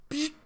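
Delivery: short "psh" made with the mouth; quick hissed whoosh effect; imitated switch-on noise. Host mimics Batman's night vision switching on, sound effect.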